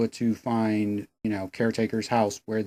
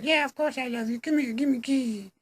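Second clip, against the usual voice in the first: very high parody falsetto; mock lovestruck woman; 13 semitones higher, 4 dB quieter than usual. Reviewer voices Emma luring the killer for a kiss, falsetto mock devotion. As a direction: gush in an exaggerated girly falsetto. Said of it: "And she's like".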